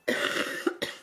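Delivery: short dry cough. Real, not acted. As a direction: cough dryly.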